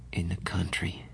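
fading into a hushed whisper; dreamy whisper. Acted. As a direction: let it drift away, barely voiced.

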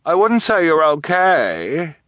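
high, drawn-out villainess call. A narrator's reading. Guardian to trapped orphans from above, calling down. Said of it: "Esme's voice called down to them".

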